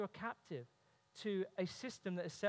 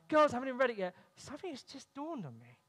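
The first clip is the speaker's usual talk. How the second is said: put-on higher, louder burst to the girls; excited call; 5 semitones higher, 6 dB louder than usual. Father calling to his daughters in excitement. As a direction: exclaim it in sudden delight. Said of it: "I'm like".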